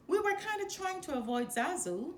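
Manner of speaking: high sheepish cub voice; a cub admitting he's dodging someone; young cub Simba. A narrator's reading. Cub Simba to uncle Scar, high and sheepish.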